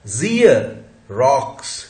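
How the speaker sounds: Xerox said zee-uh-rox with a z. Shown as a demonstration. Teacher to class, modelling the word. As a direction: start Xerox on a z sound. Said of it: "जिसको हम photocopy कहते हैं".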